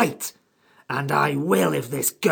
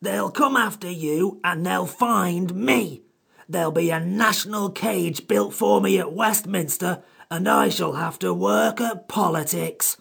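cross creature drawing out its complaint; furious creature. A narrator's reading. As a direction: scold them as an aggrieved high-voiced creature. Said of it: "The Samiad was furious".

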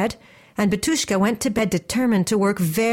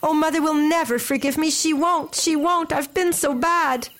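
high, self-blaming lament; upset young girl wailing mildly; distressed cry; 6 semitones higher than usual. A narrator's reading. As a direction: fret in a raised pitch, repeating it in distress. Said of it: "she cried as she saw".

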